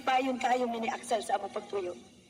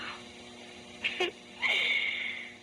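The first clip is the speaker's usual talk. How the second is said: shy giggle; 11 semitones higher than usual. Acted. Giggling about the sweet guy.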